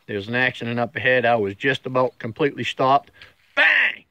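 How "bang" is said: high, punchy imitation of an impact; sudden crash exclamation. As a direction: act out the sudden hit. Acts out the car crash.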